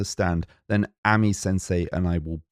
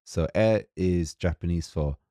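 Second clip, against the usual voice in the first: usual speaking voice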